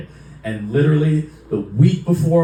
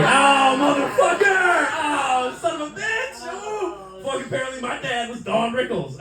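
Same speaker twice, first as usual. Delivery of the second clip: very high, drawn-out comic howl; mock outraged wail. Son bested by dad's joke, mock outraged wail. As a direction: howl in hammed-up disbelief, fading in level.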